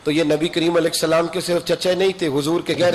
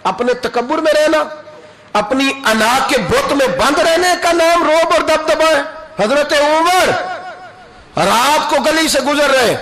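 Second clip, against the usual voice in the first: higher and louder sermon peak; fiery preaching; 9 semitones higher, 4 dB louder than usual. Acted. Preacher challenging the congregation about pride, fiery high preaching. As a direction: drive the rhetorical question home to the crowd. Preacher rising to full force.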